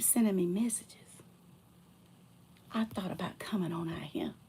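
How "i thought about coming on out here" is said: soft, breathy whisper; whispered jab. Real, not acted. Telling the ex why she came, whispered. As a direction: hiss it quietly.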